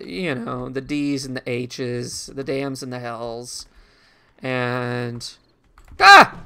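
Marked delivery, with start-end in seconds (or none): 6.0-6.4 sharp high startled cry; startled yelp. Real, not acted. Gamer jolted by a zombie, loud startled yelp. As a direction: yelp as something leaps out. Sudden jump scare in game.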